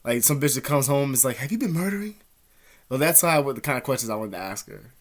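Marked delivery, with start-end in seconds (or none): 1.4-2.1 higher-pitched acted question; put-on higher voice; mock casual question. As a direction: ask it high, as a mock everyday query. Acted question, put in her partner's mouth.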